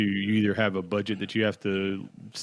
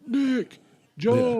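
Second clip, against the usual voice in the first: high called-out names; calling out names; 5 dB louder than usual. Pretend. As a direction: call across the store. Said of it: "I'm not gonna yell".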